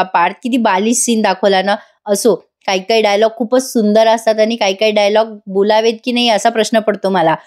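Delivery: ordinary talk, nothing marked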